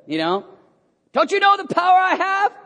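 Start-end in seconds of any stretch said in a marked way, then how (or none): 1.1-2.5 high, loud, chest-puffing bluster; pompous threatening shout; Pilate. Acted. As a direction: boast it at full volume to intimidate. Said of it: "puffing out his chest a little bit".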